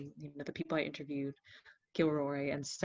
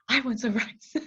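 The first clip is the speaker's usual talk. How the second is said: amused exclamation; 7 semitones higher, 6 dB louder than usual. Retold or imitated. Retold amused realisation of a link.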